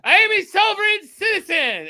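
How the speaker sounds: high-pitched, loud mock proclamation; Casey. Mocking Casey in trouble with police, high loud protest. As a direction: squawk it out way up high, far from your own voice. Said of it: "He'd be like".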